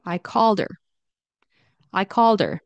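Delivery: "called her" linked as caller; dropped h. Shown as a demonstration. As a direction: glide "called" into "er". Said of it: "her, turns into er".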